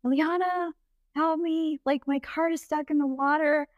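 high, put-on helpless voice; imitating friend's plea. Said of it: "You're like".

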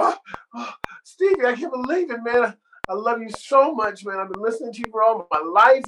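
high, gushing, acted breathlessness; out of breath. Breathless fan gushes to Stevie, imitated. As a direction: gasp it out like an overwhelmed fan. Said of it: "I'm just out of breath".

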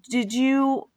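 ordinary delivery

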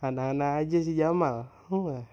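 real laugh into the words. Real, not acted. Recalling his friend Jamal's antics to viewers, laughing. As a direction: chuckle through it, amused.

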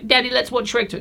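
put-on begging Daddy like a small child; child's plea. Kid asking dad for Shrek.

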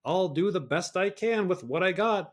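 high mock-resigned recital; sarcastic quote. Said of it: "what does my disclaimer say".

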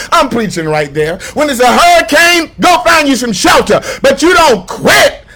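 loud, high climactic preaching shout; full-force climactic sermon cry. Real, not acted. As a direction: build the sermon to its peak and shout.

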